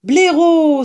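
high, loud cry of recognition; exclaiming. Acted. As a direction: cry out the name on seeing a friend. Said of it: "s'écria Maître Renard".

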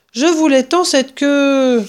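high, slow, drawn-out complaint; loud lament. A narrator's reading. Woman laments the lost souvenir tail, loud disappointment. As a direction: wail your disappointment up the stairs.

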